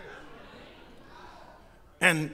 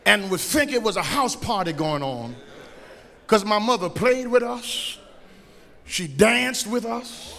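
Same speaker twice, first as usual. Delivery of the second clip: ordinary talk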